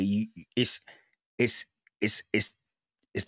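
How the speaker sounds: stumbling over words; repeating words, stuck stutter. Real, not acted. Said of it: "I'm st-, I'm stuttering".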